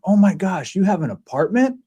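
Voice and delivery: put-on high, amazed little kid; younger self kid voice. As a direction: play the awestruck kid version of himself. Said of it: "his younger self".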